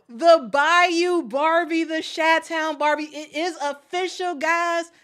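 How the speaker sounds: high, drawn-out hype call; excited hype. Real, not acted. Vlogger hyping the new player to viewers, high and excited. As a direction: announce her like a champion.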